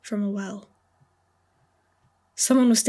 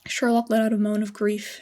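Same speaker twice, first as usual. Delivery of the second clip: usual speaking voice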